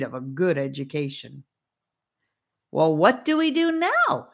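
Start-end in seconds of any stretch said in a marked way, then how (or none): 2.7-4.3 high, loud demanding question. A narrator's reading. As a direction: demand an answer, pushed up and loud. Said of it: "he demanded".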